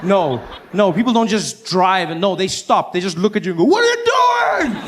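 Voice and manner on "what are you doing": much higher, loud incredulous shout; incredulous driver. Retold or imitated. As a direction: shout at the crazy driver in disbelief. Other drivers staring at him.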